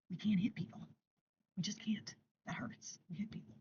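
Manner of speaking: a soft reminder fading into a whisper. Shown as a demonstration. Parent whispering the rule to a toddler, hushed. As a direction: whisper the rule gently to the child. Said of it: "Remind your hands".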